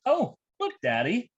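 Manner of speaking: put-on high, childlike voice, slow and wide-eyed; dad mimicking his daughter in the car; imitating young daughter. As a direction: play the innocent little girl reading a sign. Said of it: "my daughter tells me".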